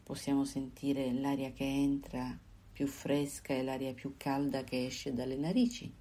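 ordinary talk, nothing marked

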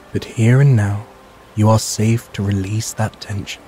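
usual speaking voice

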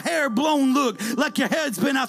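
preaching at full force. Acted. Preacher to congregation, shouting with rising fervor. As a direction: lift the room with each line.

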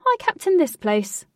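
loud, high burst of excitement; excitable, high-pitched. A narrator's reading. Captain announcing his post to the girl, excitable. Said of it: "excitable, high-pitched manner".

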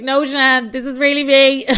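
loud, high, laughing; mock protest. Host telling Shan it really is her face, loud laughing protest. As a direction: insist loudly through a laugh. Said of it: "I was like".

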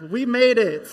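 loud high cheer; triumphant cheer; excited cheer. Pretend. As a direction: celebrate the arrival loudly. End of pretend walk.

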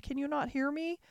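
high, pleading anguish; grieving girl over the still Beast; desperate cry. A narrator's reading. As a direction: beg him to answer through tears. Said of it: "she cried".

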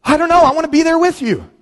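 high, eager and longing; high eager wish; eager reply. Retold or imitated. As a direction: blurt it like you want to go now. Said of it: "I said".

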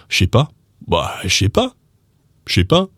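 "je sais pas" slurred to "shay pa"; casual "shay pa" for "je sais pas"; slang contraction. Shown as a demonstration. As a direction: say "je sais" as one "sh" syllable. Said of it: "that becomes".

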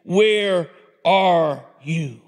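slow, spaced-out call, word by word; God calling out. Acted. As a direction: draw out each word of the call. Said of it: "the Lord God called to the man".

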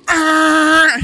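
a huge high roaring horn yell; angry honk blast. Retold or imitated. Impatient driver honking at a slow car, loud angry blast. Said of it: "someone goes".

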